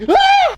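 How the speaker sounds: sudden high shriek of horror; piercing high startled scream; shocked shriek. Acted. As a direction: shriek as if the report card burned you. Mother horrified by report card.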